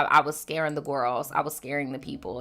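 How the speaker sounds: usual speaking voice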